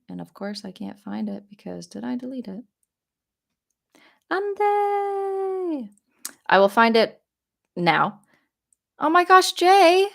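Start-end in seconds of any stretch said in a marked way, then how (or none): 4.3-5.9 high delighted call of a name; happy high greeting; excited call. Real, not acted. 9.0-10.1 high, loud delighted cry; thrilled gasp to a donor; excited shout. Real, not acted. Excited reaction to a donation.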